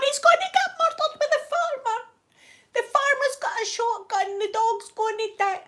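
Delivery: high, laughing squeal of fake terror; laughing mock panic. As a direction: shriek that the dog will die. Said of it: "I'm going".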